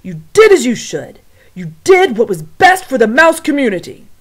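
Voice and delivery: loud commanding shout. Acted. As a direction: shout the orders down. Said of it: "shouted the head mouse again".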